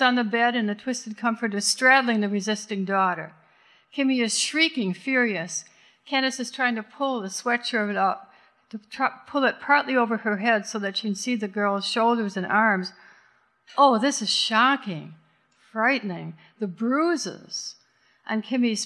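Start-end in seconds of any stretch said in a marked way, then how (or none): none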